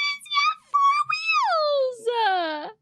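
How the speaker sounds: very high, slow squeak; squeaky character. Acted. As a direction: squeak it out like a clown. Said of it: "chuckle, content".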